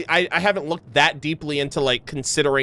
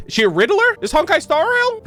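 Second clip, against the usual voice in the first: baffled disbelief; 14 semitones higher, 4 dB louder than usual. Real, not acted. Baffled by the riddling Harbinger.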